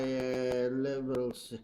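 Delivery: ordinary talk, nothing marked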